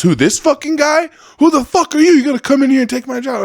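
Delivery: high, loud, outraged yelling; retold shouting match, high and heated; angry confrontation. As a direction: act out a furious worker rounding on his replacement. Acted quarrel of fired worker.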